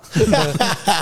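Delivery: a sudden high-pitched laugh; high burst of laughter. Real, not acted. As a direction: laugh it out, amused by his own story. Laughs at awkward party story. (忍不住高声大笑)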